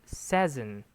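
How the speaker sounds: "season" with a short e, like SEZ-un; mispronounced season. Retold or imitated. Teacher quoting student's mistake, "season" mispronounced as "sezzen". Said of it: "the correct pronunciation is".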